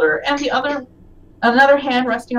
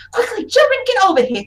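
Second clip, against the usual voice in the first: high, hurried summons; acted anxious call to children; urgent alarm; 10 semitones higher, faster than usual. Elf calling the kids away from strangers, urgent alarm.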